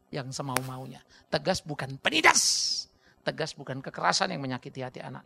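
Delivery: ordinary delivery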